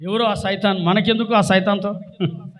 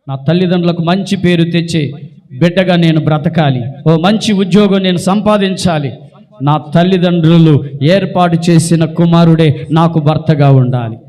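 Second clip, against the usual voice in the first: loud, insistent declaring prayer; model prayer; 4 semitones lower, 8 dB louder than usual. Shown as a demonstration. Preacher models a child's prayer for a good life, loud and fervent. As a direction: pray it out loud in firm, forceful phrases. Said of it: "ప్రార్థన చేసుకోండి".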